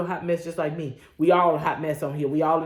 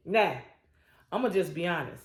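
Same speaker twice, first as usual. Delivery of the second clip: ordinary talk, nothing marked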